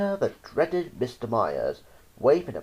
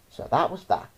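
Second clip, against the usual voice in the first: usual speaking voice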